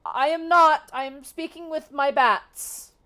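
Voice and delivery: high, loud, slow denial; loud protest. Acted. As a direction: insist loudly, covering for the hidden friends. Giantess protesting loudly.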